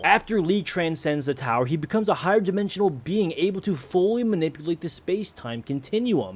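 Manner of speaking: ordinary talk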